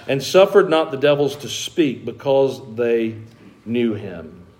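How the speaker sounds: scripture reading. Acted. Preacher reading Mark to the congregation, solemn reading. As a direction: read the passage solemnly.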